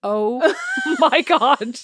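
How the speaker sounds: slow, drawn-out oh my God through a laugh; laughing disbelief. Real, not acted. Mother, stunned by her son's shopping, laughs in disbelief.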